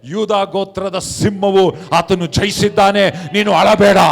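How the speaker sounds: triumphant announcement, swelling in force; angel's proclamation. Retold or imitated. Angel comforts weeping John with victory, loud proclamation. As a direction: declaim it, building to a loud finish. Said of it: "ಹೇಳ್ತಾನೆ".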